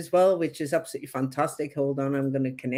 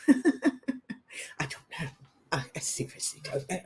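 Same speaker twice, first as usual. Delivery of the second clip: whispering; 7 dB quieter than usual. Real, not acted. Trails off, half laughing.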